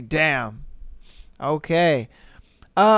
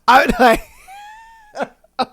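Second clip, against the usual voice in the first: ordinary delivery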